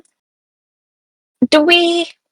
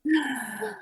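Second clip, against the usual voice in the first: excited gasp; 6 semitones higher, 14 dB quieter than usual. Real, not acted. Excited gasp at pie offer.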